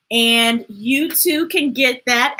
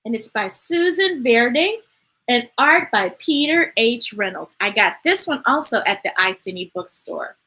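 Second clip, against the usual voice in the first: usual speaking voice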